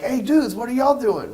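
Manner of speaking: put-on a kid hailing strangers in the woods; a kid calls to other kids in the woods, excited; excited kid greeting. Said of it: "you're like".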